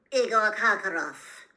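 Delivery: loud, curt call of the name; clipped official summons, loud; a loud curt voice naming the prisoner. A narrator's reading. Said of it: "said a curt voice".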